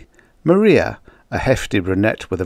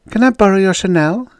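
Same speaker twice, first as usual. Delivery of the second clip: high, louder eager request; pitched-up acted eagerness; excited; 12 semitones higher, 6 dB louder than usual. A narrator's reading. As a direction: ask a girlfriend a favour, all keyed up. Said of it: "Her face was lit with excitement".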